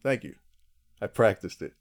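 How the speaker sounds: ordinary talk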